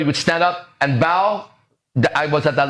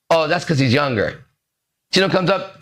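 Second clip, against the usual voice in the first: usual speaking voice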